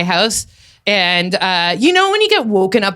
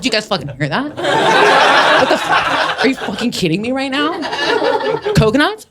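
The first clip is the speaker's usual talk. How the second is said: sarcastic disbelief; 4 semitones higher than usual. Retold or imitated. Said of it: "I was like".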